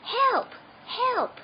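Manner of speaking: high, loud cry for help; shout for help. A narrator's reading. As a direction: raise the alarm. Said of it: "he shouted".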